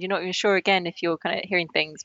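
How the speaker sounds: usual speaking voice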